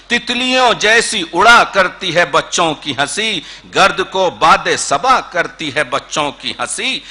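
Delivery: lilting refrain in a steady metre; Urdu poetry recitation. Acted. Poet presents a poem on children's laughter, recited with lilt. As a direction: recite rhythmically, lifting and settling line by line.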